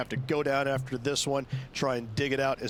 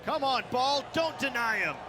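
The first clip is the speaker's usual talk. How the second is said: high pleading call; will the ball in, pitched up high; urging the ball; 11 semitones higher than usual. Acted.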